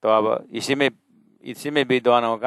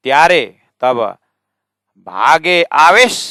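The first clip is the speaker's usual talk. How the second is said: loud, raised भागे आवेश, then pause; phrase break after आवेश; 2 semitones higher, 7 dB louder than usual. Shown as a demonstration. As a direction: stretch आवेश upward before the break. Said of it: "ऐसे ही यहाँ चुप है ये".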